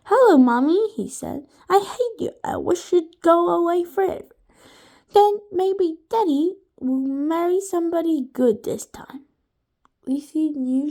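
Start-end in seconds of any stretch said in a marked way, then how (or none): 0.0-0.9 put-on higher, louder little boy greeting; a boy calling his mother sweetly; Joe's voice. A narrator's reading. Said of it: "called Joe's mother". 1.7-9.2 put-on much higher boy voice, spiteful; a spiteful child on the phone; Joe's voice. A narrator's reading. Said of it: "called Joe's mother".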